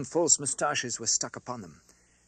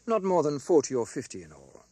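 usual speaking voice

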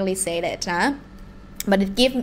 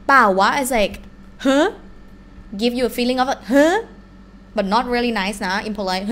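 ordinary delivery

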